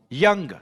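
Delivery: "यंग" as one unbroken syllable. Shown as a demonstration. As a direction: say "यंग" in a single push, no break. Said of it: "one syllable के शब्द हैं".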